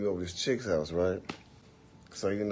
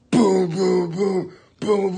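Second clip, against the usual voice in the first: loud, high booming bass noises; mock stereo boom; 11 semitones higher, 11 dB louder, much slower than usual. Imitating the stereo downstairs with loud booming.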